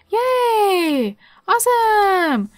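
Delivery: high, loud delighted cheer; high, loud real joy; excited cheer. Real, not acted. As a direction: celebrate the puppy's win. Dog learned to pee outside.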